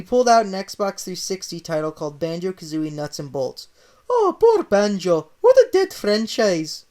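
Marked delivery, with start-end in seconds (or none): 4.1-6.8 slow, high Italian pity; pitying Mario. Acted. Mario finds Banjo game, slow mock-sad Italian. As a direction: sigh it like Mario mourning.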